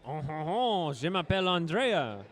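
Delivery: best French accent. Shown as a demonstration. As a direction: put on a showy French accent. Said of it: "let me hear your best French accent".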